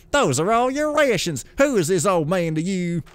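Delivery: high, incredulous scoff. Acted. Rich novice to poor novice over rations, scoffing. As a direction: scoff at a foolish giveaway. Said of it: "The rich novice scoffed".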